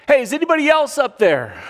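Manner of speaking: high, loud desperate call. Retold or imitated. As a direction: shout up past God for someone else. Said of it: "and he says".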